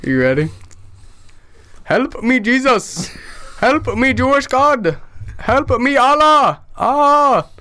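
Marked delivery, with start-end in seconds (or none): 1.8-7.5 put-on high-pitched Indian-accented pleading; mock Indian accent. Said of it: "I love your accent".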